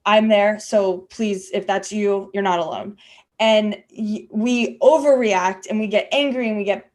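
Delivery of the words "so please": normal talking voice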